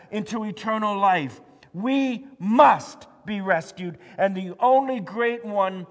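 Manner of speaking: loud, high, urgent preaching; full-force preaching. Acted. Pastor urging the church that we cannot save ourselves, preaching loud. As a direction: drive it home to the congregation.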